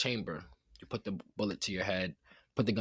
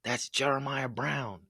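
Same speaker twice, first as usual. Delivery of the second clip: put-on higher, kid calling his mom, pitched up; excited little kid; 6 semitones higher than usual. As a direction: play an excited boy spotting his hero. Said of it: "and he goes".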